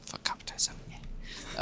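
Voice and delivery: a hushed, breathy curse under the breath; whispered aside. Real, not acted. Podcaster whispering a jab at capitalism to his co-host.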